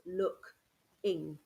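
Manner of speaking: look, then ing, as two chunks; two-chunk reading; big chunks. Shown as a demonstration. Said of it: "see it in big chunks".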